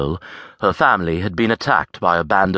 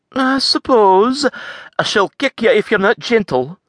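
hide a smile while you tease him; coy Scots lass; 3 dB louder than usual. A narrator's reading. Avril warning the farrier, coy and teasing. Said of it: "She attempted to hide her smile".

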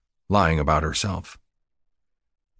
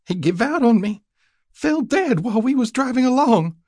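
very high-pitched, upset country woman; distraught new widow. A narrator's reading. Young woman tells a rider of husband's death, distraught. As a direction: wail the news in a high voice.

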